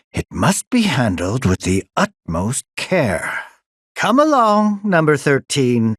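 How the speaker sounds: normal talking voice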